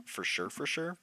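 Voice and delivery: ordinary delivery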